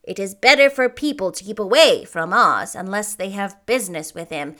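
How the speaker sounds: grave. A narrator's reading. Munchkin warning the girl about the dangerous road, grave. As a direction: warn with grave weight. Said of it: "answered Boq gravely".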